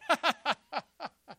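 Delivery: loud, high staccato cackle; hammed-up laugh of derision; mocking laugh. Elijah laughing at the frantic prophets, mocking cackle. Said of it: "And Elijah's going".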